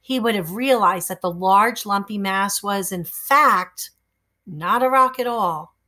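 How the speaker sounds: ordinary delivery, nothing marked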